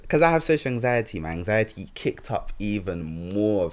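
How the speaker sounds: ordinary talk, nothing marked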